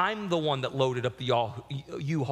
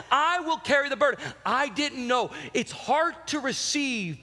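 preaching at full force; 8 semitones higher than usual. Acted. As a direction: preach it, building conviction. Preaching testimony.

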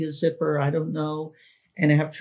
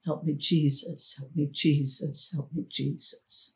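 fading from soft to a near-breathless hush; pleading prayer sinking toward silence; silent prayer; 7 dB quieter than usual. Retold or imitated. A frightened child praying under her breath, fading out. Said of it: "I prayed".

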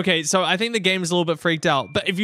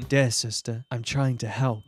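flat, lower, cult-like and creepy; sinister monotone; 7 semitones lower, 3 dB quieter than usual. Shown as a demonstration. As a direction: read it like a calm cult leader. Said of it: "Read that in a more monotone voice and it becomes very sinister".

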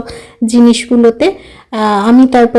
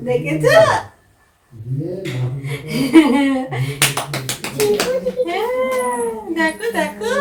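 higher, gentle excited coaxing; 6 semitones higher, 4 dB quieter than usual. Real, not acted. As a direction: show her the gifts, high and delighted. Waking daughter with presents.

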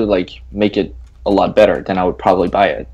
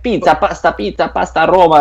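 mock Italian; 8 semitones higher than usual. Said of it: "you probably only heard".

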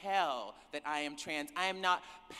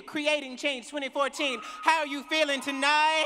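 shouting to the crowd, higher and louder, punching how; louder call to the crowd; 8 semitones higher, 8 dB louder than usual. Real, not acted. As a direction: demand a bigger answer from the hall. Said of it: "I don't know if I believe you".

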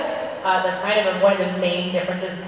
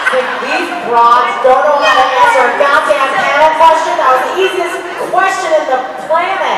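loud, high-pitched hammed-up indignation; mock outrage; 8 semitones higher, 7 dB louder than usual. Actor voicing the fan leaving after a bad answer, mock outrage. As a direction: rant like a fed-up audience member, played for laughs. Mimics an annoyed fan walking out.

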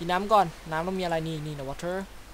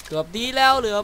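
higher, louder almost-there cry; excited outburst; 7 semitones higher, 7 dB louder than usual. Real, not acted. Gamer testing a water gun, excited cry. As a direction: cheer it nearly worked.

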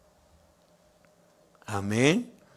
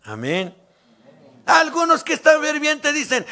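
ordinary delivery

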